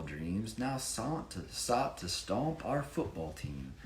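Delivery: sing-song picture-book narration; rhyming story reading. A narrator's reading. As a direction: read the rhymes to a child with energy. Reads rhyming story aloud.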